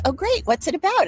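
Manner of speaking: high, chirpy quoted friend; friend's bright reply. Retold or imitated. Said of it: "And she goes".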